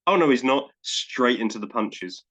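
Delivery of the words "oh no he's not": louder quoted comic aside; requoted wrestler punchline. Retold or imitated. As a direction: lightly mimic his sudden reversal.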